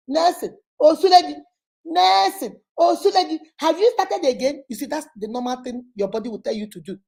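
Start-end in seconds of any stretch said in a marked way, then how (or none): 0.1-4.6 high-pitched, indignant calling of the name; acted outburst of reproach, pitched well up; scolding. Coach acting out a wife confronting her yelling husband, scolding. Acts angry reaction to yelling spouse.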